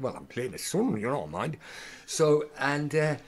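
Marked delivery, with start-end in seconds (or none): 0.0-1.6 lightly sing-song Geordie lilt; a touch of stage Geordie; Geordie accent. Acted. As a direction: put on a northeastern Geordie accent. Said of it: "doing all sort of accent".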